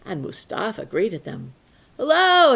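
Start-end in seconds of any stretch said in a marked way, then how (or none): 2.0-2.6 louder and higher bright greeting; boy cheerfully greeting a funeral procession; cheerful loud hello. Acted. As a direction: call out a happy hello.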